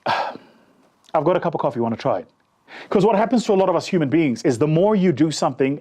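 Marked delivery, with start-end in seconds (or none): 0.1-2.3 deflated offer. Retold or imitated. Worn-down salesman offering coffee to a fourth stranger, deflated. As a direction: mumble it like you expect a no.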